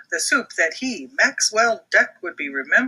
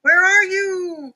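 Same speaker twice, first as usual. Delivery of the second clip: high, loud calling out; Brody calling for his friend; 11 semitones higher, 4 dB louder, slower than usual. Acted. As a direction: call for Max across the house. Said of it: "called Brody".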